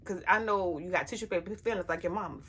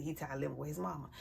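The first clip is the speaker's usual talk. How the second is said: much softer, lower, quick; muttered echo; 6 semitones lower, 13 dB quieter, faster than usual. Retold or imitated. Mother echoing her son's complaint, muttered and soft. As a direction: let it fade to a dry aside.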